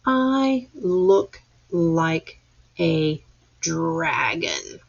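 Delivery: slow word-by-word reading; slow pointing read. Shown as a demonstration. Teacher rereading with class: slow, word by word. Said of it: "read that again".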